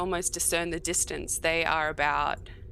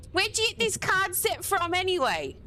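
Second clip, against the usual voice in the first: high guard voice; 13 semitones higher than usual. Acted. Guard voice in overheard conversation.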